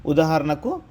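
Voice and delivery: ordinary talk, nothing marked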